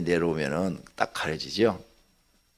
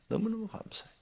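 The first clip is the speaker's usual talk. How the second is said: 너무너무 said again much softer, still lifted; softer repeat; 13 semitones higher, 8 dB quieter than usual. Real, not acted. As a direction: echo the thanks quietly to yourself. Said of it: "진짜 화가 안 나".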